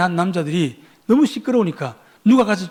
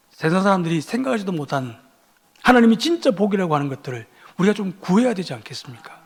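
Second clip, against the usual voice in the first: ordinary talk, nothing marked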